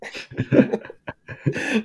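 high airy burst of laughter; breathy laugh. Real, not acted. Laughs after own impression.

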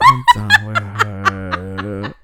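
slow, low laughing I'm done with her; laughing mock disgust. Reacting to the gifted fake wallet, a laughing mock disowning. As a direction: drag it out while cracking up.

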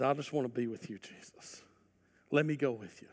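hushed devoted longing; tender plea. Acted. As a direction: plead gently to stay with him. Freed man pleading tenderly.

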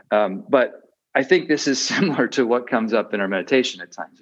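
normal talking voice

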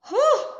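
sharp high "whew"; relieved exhale. Acted. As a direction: let out a loud, high whew. Steadying herself before a deep breath.

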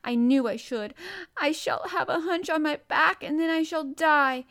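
put-on high, loud, despairing child; choked wailing. A narrator's reading. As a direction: wail in panicked despair. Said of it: "choked out Colin".

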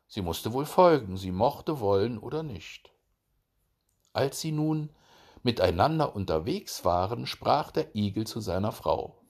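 plain reading voice

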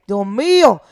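normal talking voice

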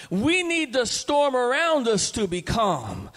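loud, rising preacher's exhortation; fervent preaching. Acted. Preacher exhorts the congregation, fervent and loud. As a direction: drive the point home to the church.

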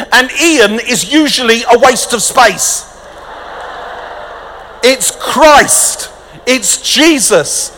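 high, loud, emphatic preaching; preaching at full force. Real, not acted.

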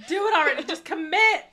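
loud laughing urging; exasperated shout. Acted. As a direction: yell it in fake exasperation. Mock urging researchers.

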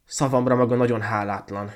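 loud, indignant reproach; angry. A narrator's reading. Student scolds the ungrateful girl, angrily. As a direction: scold with mild outrage. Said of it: "mondta haragosan a diák".

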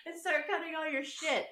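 high, slow taunting voice; mother taunting her son after cutting his pillow, high and slow; mock taunting. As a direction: taunt him, drawn out and high. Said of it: "And I was like".

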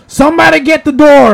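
shouted call from inside; loud yell. Retold or imitated. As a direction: yell it across the building. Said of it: "like, loud voice".

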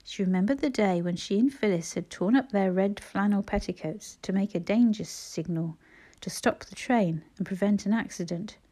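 plain reading voice

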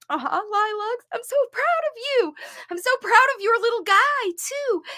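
high, fast, teary cheering; emotional praise. Real, not acted. Coach cheers a mother, teary and proud. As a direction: cheer her on, choked up with pride. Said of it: "I get teary and emotional".

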